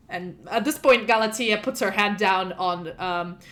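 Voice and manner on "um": usual speaking voice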